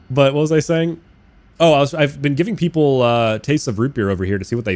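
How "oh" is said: normal talking voice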